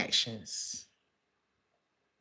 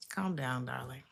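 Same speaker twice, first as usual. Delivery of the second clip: whisper; 6 semitones lower, 10 dB quieter than usual. Real, not acted. Soothing whisper to the querent.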